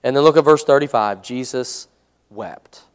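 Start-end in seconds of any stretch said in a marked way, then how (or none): 1.2-2.8 solemn near-whisper of scripture; hushed solemn reading. Acted. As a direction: drop to a soft, hushed voice. Reads the shortest verse dramatically.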